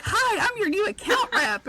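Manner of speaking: high, bright-toned rep greeting; eBay rep announcing himself; cheery rep. Retold or imitated. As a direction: chirp it like a friendly rep. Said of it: "he was like".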